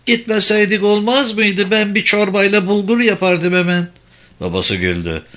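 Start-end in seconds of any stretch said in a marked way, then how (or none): 0.1-3.9 put-on high old mother's reluctant plea; unhappy mother's high protest; displeased, reluctant. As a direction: plead gently against going, pitched high. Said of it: "pek de sevinmedi annesi".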